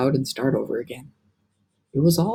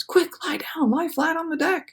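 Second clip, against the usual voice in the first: urgent; 13 semitones higher than usual. Acted. James urgent warning.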